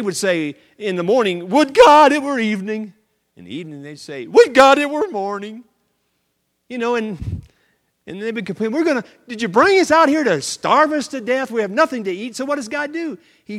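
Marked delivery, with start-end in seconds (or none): 1.5-2.9 high, loud complaining whine; loud whiny grumble; whining Israelites. Acted. 4.3-5.6 high, loud complaining whine; loud whiny grumble; whining Israelites. Acted. 8.7-12.4 high, loud complaining; higher, louder grumble; whining Israelites. Acted.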